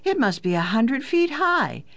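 put-on high-pitched, wide-eyed child's wonder; amazed exclamation. A narrator's reading. Daughter to father on seeing the mast, amazed. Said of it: "she exclaimed".

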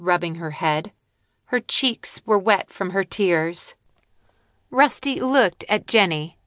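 ordinary delivery

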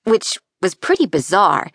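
ordinary delivery

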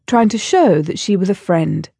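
plain reading voice

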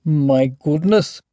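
slow, mildly amazed dragon; understated amazement; amazed breath. A narrator's reading. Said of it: "the dragon breathed in a whisper, amazed".

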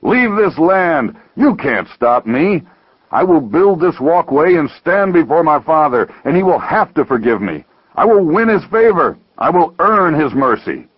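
worked-up zealot's tirade; angry screaming. Acted. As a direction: declare it in heated, stubborn defiance. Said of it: "screamed the rock stacker".

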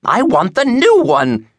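very high, slow, loud demanding wail; wailing old woman. A narrator's reading. Old woman demanding a book from clerk, wailing. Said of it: "she wailed".

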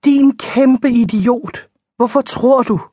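slow exasperated cry; exasperated outburst. Acted. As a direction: throw it at him in disbelief. Frustrated outburst at her protector.

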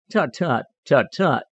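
mild sharp tutting; sharp scolding. A narrator's reading. Jenny Wren chides Peter Rabbit, mildly scolding. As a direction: scold lightly with crisp, separated tuts. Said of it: "in her sharp, scolding voice".

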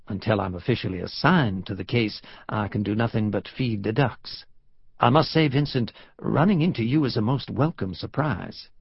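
put-on genteel, courteous Southern accent; courtly American South accent; cultured Southern drawl. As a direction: greet an old colleague in a Southern drawl. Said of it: "the cultured Southern drawl he remembered".

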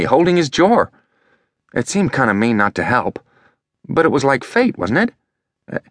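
put-on a boy explaining himself, a bit defensive; lightly voiced boy Elliot. A narrator's reading.

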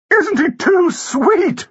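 high, drawn-out cooing demand; squealing society-lady rapture; excited gushing. A narrator's reading. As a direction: gush at the top of your voice range. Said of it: "rose excitedly".

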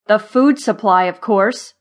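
loud, irritated retort; snapped reply. A narrator's reading. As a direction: snap back as if it's obvious. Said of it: "Zimmer snapped".

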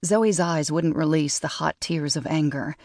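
plain reading voice

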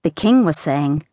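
ordinary delivery, nothing marked

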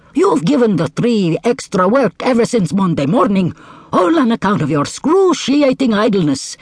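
high, scolding djinn; stern djinn. Acted. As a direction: reproach the lazy camel.

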